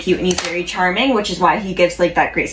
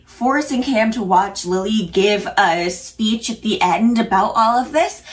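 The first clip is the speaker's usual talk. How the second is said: ordinary delivery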